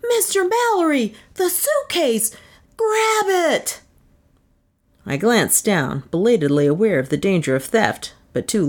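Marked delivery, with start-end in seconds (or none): 0.1-3.8 high, frantic shouted warning; agonized scream. Acted. Pat screams a warning to Mallory about the suitcase, high and frantic. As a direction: scream it out in panic, pitch way up. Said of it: "Pat Pending's agonized scream".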